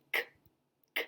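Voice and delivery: "k" as a voiceless click of breath with no vowel; pure K sound. Shown as a demonstration. Teacher showing children the C sound, pure voiceless k. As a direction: say "k" alone, no uh after it. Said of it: "Now notice I didn't say k".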